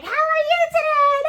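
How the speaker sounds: hammed-up saccharine sing-song; fake sweet coworker. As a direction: imitate a gushing director cooing at a kid. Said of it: "all up in the kid's face, like".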